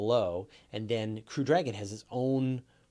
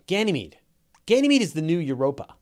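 excited; 4 semitones higher, 8 dB louder than usual. Real, not acted. Enthusiastic pitch for Ganymede.

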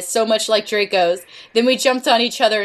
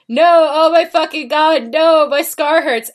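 high, loud mock cry of agony; pained scream; 6 semitones higher, 4 dB louder than usual. A narrator's reading. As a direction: cry out in melodramatic pain. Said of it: "he started to scream".

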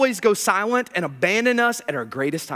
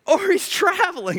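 pitched up high in mockery; mock taunt; 10 semitones higher than usual. Shown as a demonstration. Preacher redoing Elijah's taunt, high and mocking. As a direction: sing it out higher, teasing.

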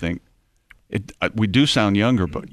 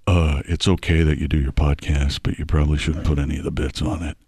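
imitated boss; 7 semitones lower than usual. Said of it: "whose voice you're doing there".

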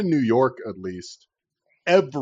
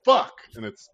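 normal talking voice

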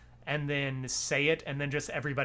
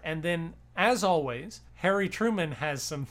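usual speaking voice

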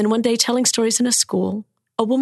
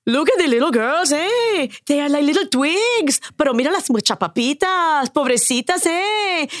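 Mexican street ladies; 6 semitones higher than usual. Retold or imitated. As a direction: coo with pity like the tortilla sellers. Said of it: "would look at my sister and say".

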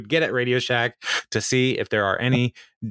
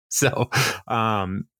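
ordinary talk

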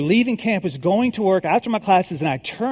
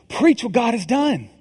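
declared louder, a call to the congregation; louder proclamation; 4 semitones higher, 3 dB louder than usual. Acted. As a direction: proclaim it like a rallying cry. Repeated sermon refrain.

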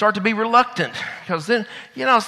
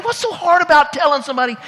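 ordinary delivery

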